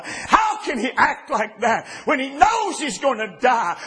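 ordinary talk, nothing marked

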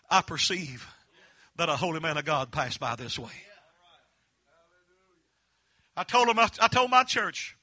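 loud, rising preacher's cry; preaching at full force. Acted. Closing the sermon to challenge the church, preaching at full force. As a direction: build it up and shout to the church.

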